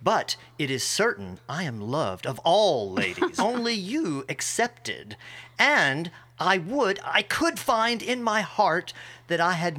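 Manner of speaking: a cocky Benedick mocking Beatrice; Benedick reading.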